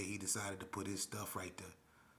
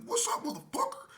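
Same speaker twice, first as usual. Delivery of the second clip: mock challenge; 8 dB louder than usual. Retold challenge.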